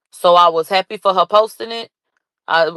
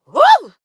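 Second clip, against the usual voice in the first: joyful whoop; 14 semitones higher, 6 dB louder than usual. Real, not acted. Celebrating the news.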